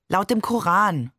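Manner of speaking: ordinary delivery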